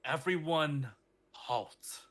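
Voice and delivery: big booming call across the hall. Acted. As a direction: boom it out to the whole hall. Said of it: "hear a booming voice across the halls".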